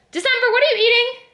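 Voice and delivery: high, fast call to the pet; sing-song pet-talk question. Real, not acted. Calling to her pet.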